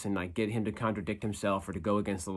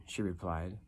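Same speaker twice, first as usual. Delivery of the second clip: usual speaking voice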